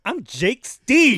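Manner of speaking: high, slow, hammed-up swagger voice; mock Rube intro. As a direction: drag it out like a cocky guy strutting in. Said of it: "Rube walks in like".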